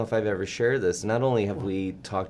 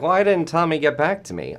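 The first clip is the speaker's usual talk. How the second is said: put-on higher, louder old-timey 1940s voice; a hint of a 1940s radio voice; 9 semitones higher, 5 dB louder than usual. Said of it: "I've got this, like, old-timey, like, nineteen forties voice".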